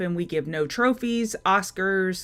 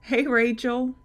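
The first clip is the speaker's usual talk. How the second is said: usual speaking voice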